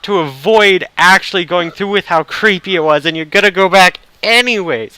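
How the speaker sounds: loud, high exasperated protest; real frustration. Real, not acted. Gamer railing at a game's creepy plot, frustrated. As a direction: cry out in frustration at the story. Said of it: "I'm so frustrated".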